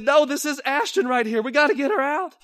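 high, loud refusal; Billy shouting back at the miners; desperate refusal. Acted. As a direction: refuse to leave her.

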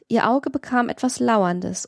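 plain reading voice

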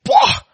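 sudden high pah burst; high exclamation. Real, not acted. Preacher bursting out at the congregation, high pah. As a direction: pop out a sharp pah.

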